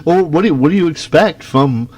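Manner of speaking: ordinary talk, nothing marked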